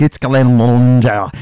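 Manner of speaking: a slow, mock Scottish "It's Glenmorangie." with a rolled, fussy "Glenmorangie"; mock Scottish accent. Host mimics a Scot correcting Americans, slow mock Scottish accent. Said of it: "somebody from Scotland explain to you".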